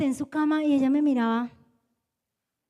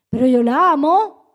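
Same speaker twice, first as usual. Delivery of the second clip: raised, louder insistence; emphatic protest; 11 dB louder than usual. Retold or imitated. Mother protests to God that she loves her daughter, loud. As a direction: protest hard that you do love her.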